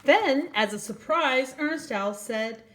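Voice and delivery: gentle storybook reading voice; reading to children. A narrator's reading. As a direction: read it like bedtime story time for little ones. Reads a picture book aloud.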